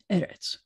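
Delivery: eretz corrected to first-syllable stress. Shown as a demonstration. Teacher repeating the right form after the wrong one, first-syllable stress on eretz. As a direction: hit the "e" of eretz, leave "retz" light. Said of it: "accent's always gonna be on the first syllable".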